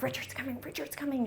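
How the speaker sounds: a lightly voiced hushed thrill; hushed excited buzz. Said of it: "It's like".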